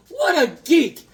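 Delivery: high, loud, slow laughing jeer; laughing mockery. Real, not acted. Mocking Goody Two Shoes with a laugh. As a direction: laugh it out at a silly hero.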